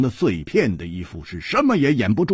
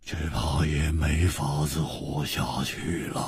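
low, slow, hopeless drawl; weary, drawn-out resignation; bleak resignation; 5 semitones lower, 4 dB quieter, slower than usual. Acted. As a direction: say it slowly and low, all hope gone. Hopeless consolation while facing death.

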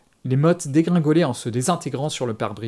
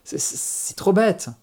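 high, slow, stammering protest; halting, panicked voice; panicky stammer; 7 semitones higher, slower than usual. A narrator's reading. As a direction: stumble over the first words in rising panic. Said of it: "quelques beaux zestes de panique dans sa voix".

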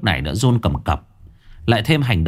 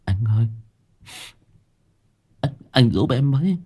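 stammering plea; 4 semitones higher than usual. Acted. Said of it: "lắp bắp".

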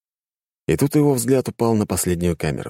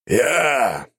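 eerie voice; 7 semitones higher than usual. A narrator's reading. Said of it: "произнёс жуткий голос".

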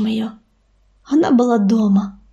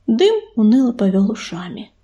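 ordinary delivery, nothing marked

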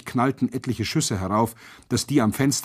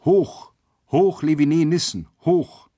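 high, raised chanting cry; whole-cell cheer; 6 semitones higher, 4 dB louder than usual. A narrator's reading. Whole cell repeats the cry on a signal, shouting. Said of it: "wiederholte die ganze Zelle".

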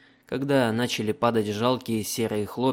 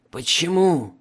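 loud bitter cry of why; 6 semitones higher, 6 dB louder than usual. Acted. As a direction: ask it with raw bitterness. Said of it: "с горечью спросил пленник".

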